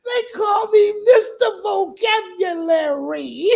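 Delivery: high squealing laugh through the words. Real, not acted. Repeats quote laughing.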